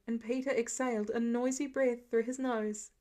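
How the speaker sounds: usual speaking voice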